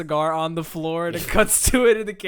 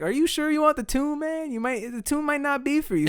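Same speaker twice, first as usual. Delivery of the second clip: higher-pitched imitation of a questioning producer; doubtful producer; 10 semitones higher than usual. Producer doubting the auto-tune, imitated higher and questioning. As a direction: question him in a raised, put-on pitch.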